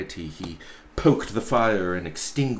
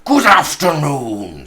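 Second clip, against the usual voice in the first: louder, final curt dismissal; 6 semitones higher, 11 dB louder than usual. Acted. Scrooge cuts off his nephew's greeting, loud and curt. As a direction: cut him off, loud. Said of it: "said Scrooge".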